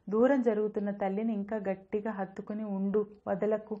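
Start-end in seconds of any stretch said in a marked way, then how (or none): none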